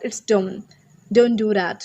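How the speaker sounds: "don't" said as "don", no T; T dropped. Shown as a demonstration. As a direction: run "don't" into the next word, dropping the final T. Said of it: "flow में बोलेंगे तो टी नहीं बोलते". ("don't" का ट हटाकर बोलें)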